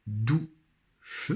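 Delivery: "douche" split into dou, pause, che; syllable by syllable. Shown as a demonstration. Teacher helping pupils find the syllable, syllable by syllable. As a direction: sound out "douche" slowly in two beats. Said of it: "première syllabe".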